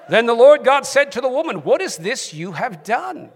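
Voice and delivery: emphatic reading in a British accent. Shown as a demonstration. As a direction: read it out in your best British accent. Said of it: "in your best British accent".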